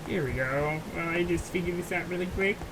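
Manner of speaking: quiet, thin frog-puppet voice; Kermit the Frog voice. Pretend. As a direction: talk like a soft-spoken frog puppet. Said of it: "my Kermit the Frog voice".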